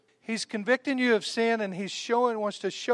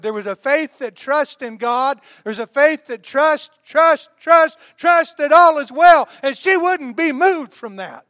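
louder and louder, slow, higher preaching climax; preaching at full force; 5 semitones higher, 10 dB louder, slower than usual. Real, not acted. Preacher roars to the church about her faith. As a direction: build each trusts into a roar.

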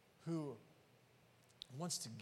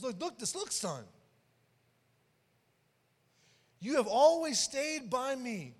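emphatic father; 6 semitones higher, 7 dB louder than usual. Retold or imitated. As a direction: drive the words home to the son. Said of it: "Get what the father says".

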